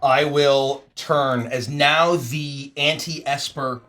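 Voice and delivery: usual speaking voice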